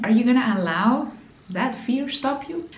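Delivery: ordinary talk, nothing marked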